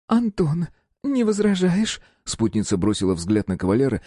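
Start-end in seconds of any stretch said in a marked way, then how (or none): 0.1-2.0 playful woman. A narrator's reading.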